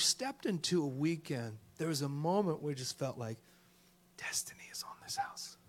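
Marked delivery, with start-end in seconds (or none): 4.2-5.6 soft whispered secret; awed whisper. Real, not acted. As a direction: whisper it with awe. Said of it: "whispered awe".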